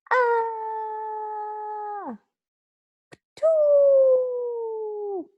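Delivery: scream, then spit it out hard; giant's shriek ending in a spit. Acted. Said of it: "I screamed and I hollered".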